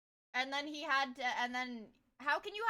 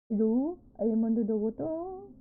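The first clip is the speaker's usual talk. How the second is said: lower, tinny "I am under the water" meme voice; Pringles-can voice; 6 semitones lower, 3 dB louder than usual. Pretend. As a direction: say it like you're stuck in a can, lower. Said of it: "I sound like I'm in a Pringles can".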